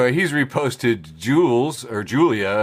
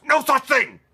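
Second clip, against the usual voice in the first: mock all-caps shout; 12 semitones higher than usual. Host reading an all-caps post, mock shout. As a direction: read the all-caps like yelling.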